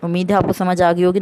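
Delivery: ordinary talk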